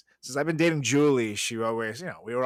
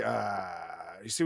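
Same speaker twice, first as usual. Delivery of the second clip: ordinary talk, nothing marked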